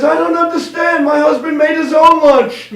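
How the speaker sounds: high, loud comic widow voice; baffled Polish widow. Acted. Polish widow at the group funeral, high puzzled wail. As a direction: protest in hammed-up widowed bewilderment. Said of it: "The Polish woman says".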